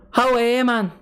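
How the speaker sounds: high, exaggerated copy of Geordie; Geordie accent attempt. Shown as a demonstration. Streamer copying an accent tutorial, high Geordie attempt. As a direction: mimic a Geordie call, high-pitched. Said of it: "look up a Newcastle accent".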